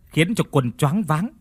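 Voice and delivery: ordinary delivery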